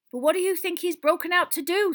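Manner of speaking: high, loud, worried question; Madame Rosmerta, alarmed. Acted. Landlady asks minister about the escape, alarmed. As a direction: press him anxiously.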